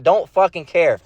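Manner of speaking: ordinary talk, nothing marked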